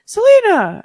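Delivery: high, loud call of a name from far off; retold yell across a lot. Stranger shouting to Selena across a parking lot, loud high call. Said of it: "this woman yells across the parking lot".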